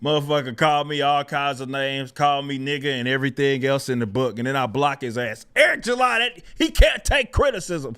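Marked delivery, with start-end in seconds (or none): 5.6-8.0 high mocking whine; higher mocking whine of the haters; mock critic. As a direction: parrot the haters in a raised voice. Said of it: "mocking critics' refrain".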